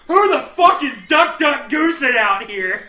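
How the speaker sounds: loud angry yell; shouting, cursing at ducks; shouted. Retold or imitated. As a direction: bellow it at the birds. Retold yelling at geese.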